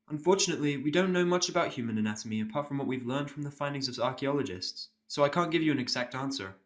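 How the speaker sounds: a careful, apologetic pony nurse. Acted.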